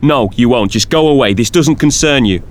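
irritated brush-off; snapping. Acted. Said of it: "the Doctor snapped".

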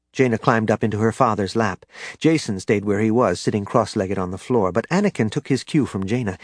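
ordinary delivery, nothing marked

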